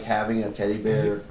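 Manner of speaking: ordinary talk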